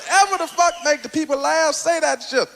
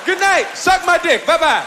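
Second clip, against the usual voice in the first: loud, brash sign-off; louder, cocky farewell; mock exit yell; 4 dB louder than usual. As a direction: shout it as you storm off stage. Mock comic's exit line.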